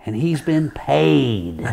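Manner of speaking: a hint of a pointed judge drawing out chiropractor; imitating the judge. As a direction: judge warning the jury about the paid chiropractor. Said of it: "and he says".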